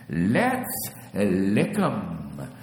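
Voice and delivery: loud, drawn-out frog croak; heavy froggy croak. A narrator's reading. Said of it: "croaked the frogs".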